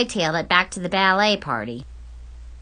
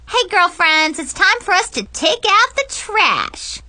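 high, loud, playful call; rally the girlfriends with a teasing whoop; hammed-up high-pitched tease; 11 semitones higher, 5 dB louder than usual. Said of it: "I teased".

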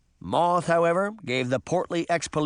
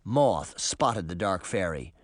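usual speaking voice

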